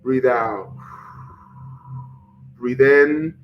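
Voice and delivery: slow, even breathing-exercise voice; guided meditation. Acted. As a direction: guide the viewers through breath and mantra.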